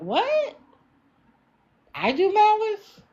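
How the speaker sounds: high-pitched, incredulous questioning squeak; mock disbelief. Host re-enacting her thought on misreading a comment, incredulous. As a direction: replay your baffled reaction way up high. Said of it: "I just saw malice and thought".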